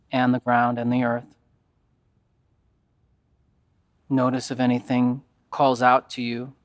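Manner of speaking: calm, even yoga nidra guidance with long pauses; guided meditation. Acted.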